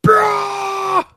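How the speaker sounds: high loud mock yell; mock porn shout. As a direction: bellow like a hammed-up porn actor. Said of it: "Are you like one of those Euro porn guys".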